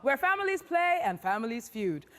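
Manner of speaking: high, bright game show welcome; excited host greeting. Acted. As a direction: greet the audience with big energy.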